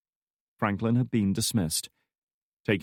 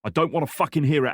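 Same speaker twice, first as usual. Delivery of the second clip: fast, higher angry snarl; 7 semitones higher, 5 dB louder, faster than usual. Acted. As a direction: spit it out in fury. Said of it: "he snarled".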